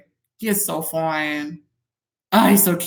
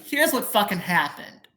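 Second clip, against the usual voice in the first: ordinary delivery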